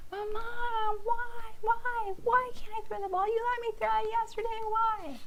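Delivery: put-on high, loud pleading kid voice; a child nagging mom over the ball, high and insistent; whiny child.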